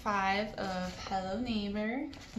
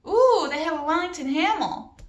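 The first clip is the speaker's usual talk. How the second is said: high, loud excited ooh; light up at the shop window; dad spotting a fancy furniture store; delighted dad; 7 semitones higher, 8 dB louder than usual. A narrator's reading.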